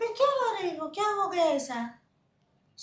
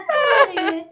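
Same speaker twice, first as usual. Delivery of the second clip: anxious plea; 6 semitones higher, 8 dB louder than usual. Acted. Worried relative pleads with crying woman.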